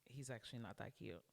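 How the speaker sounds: suddenly deflated, quiet aside; unimpressed. Retold or imitated. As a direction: take it back under your breath, losing interest. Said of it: "my face is like".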